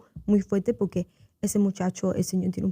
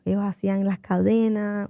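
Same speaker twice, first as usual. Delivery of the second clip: ordinary talk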